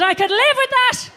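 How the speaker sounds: high, loud and pleased; delighted. Retold or imitated. Comic voicing her delighted thought, high and loud. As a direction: squeal with satisfaction.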